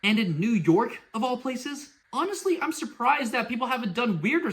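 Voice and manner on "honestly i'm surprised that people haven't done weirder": surprised. Real, not acted. Exclaims in disbelief.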